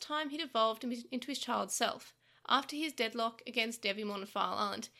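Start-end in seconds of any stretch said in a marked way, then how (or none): none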